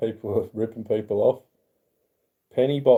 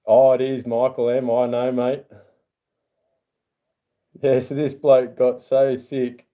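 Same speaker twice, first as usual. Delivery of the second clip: ordinary talk, nothing marked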